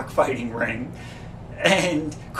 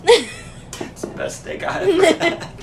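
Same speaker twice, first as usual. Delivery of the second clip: much higher, slow, laughing through it; laughing mock praise; 5 dB louder than usual.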